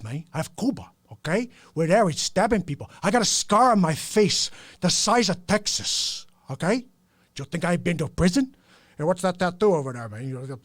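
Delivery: put-on Tony Montana Cuban accent, boastful; Scarface Cuban accent. Scarface boasting of his prison past, Cuban accent. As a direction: tell his immigration tale in Cuban accent. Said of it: "Scarface was my favorite impression".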